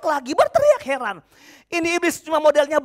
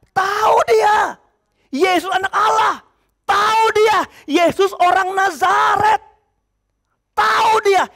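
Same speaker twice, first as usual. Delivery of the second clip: loud, higher demon shout; 6 semitones higher, 4 dB louder, slower than usual. Acted. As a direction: cry it out as the demon who knows him. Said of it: "Apa teriakan iblis kali ini?". (teriakan keras iblis)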